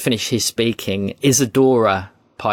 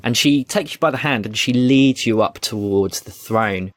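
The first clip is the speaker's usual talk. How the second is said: ordinary talk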